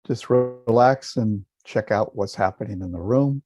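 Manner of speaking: calm, measured meditation voice with long pauses; guided meditation. Acted. Teacher guiding listeners to relax and feel a smile, calm meditation voice. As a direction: guide listeners gently inward.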